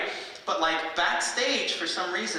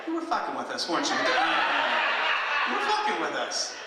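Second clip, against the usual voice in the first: laughing disbelief. Retold or imitated. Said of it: "I was like".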